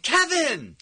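high-pitched delighted call-out; imitating a fan calling a name in recognition; excited greeting. Excited fan spots him at festival.

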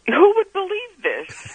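high, drawn-out incredulous question; retold disbelief. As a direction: voice leaps up in mock disbelief. Retelling her own amazed reaction.

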